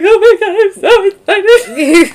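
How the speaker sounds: imitated excited sister. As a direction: squeal it like a weepy overjoyed sister. Sister voiced, crying with joy.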